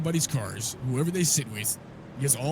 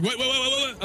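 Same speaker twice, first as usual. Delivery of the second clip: high, panicked stammer of repeated whats; mock a terrified cop tripping over one word; imitating a stuttering cop; 10 semitones higher than usual. Retelling the scared cop at gunpoint, stammering high and panicked. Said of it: "He goes, he's stuttering".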